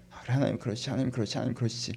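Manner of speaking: soft, idle mumble to oneself; mere murmuring. Pretend. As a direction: mumble it under your breath, going nowhere. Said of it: "읊조리고만 있지 말고".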